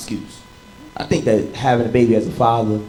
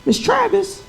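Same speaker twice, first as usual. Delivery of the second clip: mock polite confrontation. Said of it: "I was like".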